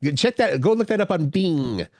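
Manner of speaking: "Bing" said as "Beeng"; long-ee BING. Retold or imitated. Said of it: "it sounded like a lot of people".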